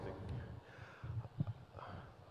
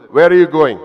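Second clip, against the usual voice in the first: louder, probing mother voice; mother quizzes her grown son at the door, louder and probing; mother questioning; 7 dB louder than usual. Retold or imitated. Mother questioning him like schoolboy.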